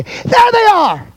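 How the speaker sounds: very high, loud, drawn-out cry; triumphant shout. Real, not acted. Preacher presenting the credentials, triumphant shout. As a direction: shout it out slowly, pitched right up.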